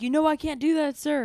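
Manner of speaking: high, loud, strained yell; shouting. Retold or imitated. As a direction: shout back in defiance at a superior. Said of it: "shouting at each other".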